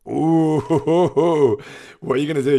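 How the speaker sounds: excited. Real, not acted. Excited reaction to a dice roll.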